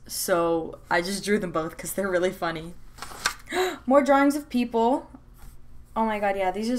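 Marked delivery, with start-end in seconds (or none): 2.8-3.8 quick high breathy gasp of delight; sharp gasp. Real, not acted. Flipping the sketchbook, a sudden delighted gasp. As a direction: catch your breath at the next page.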